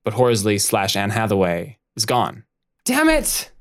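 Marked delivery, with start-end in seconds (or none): 2.8-3.5 frustrated outburst. Acted. Harriet finds Horsley gone.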